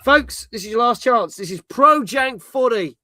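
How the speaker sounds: a badly faked Australian announcer plugging the game; mock Australian promo voice, drawn out and loud; terrible Australian accent. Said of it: "that was a terrible accent".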